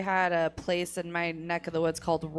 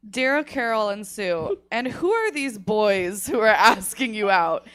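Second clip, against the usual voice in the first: stern advice columnist, louder, amused; prim disapproving aunt; 3 semitones higher, 7 dB louder than usual. Acted. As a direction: read it like a scandalized old columnist. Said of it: "And then Abby's response".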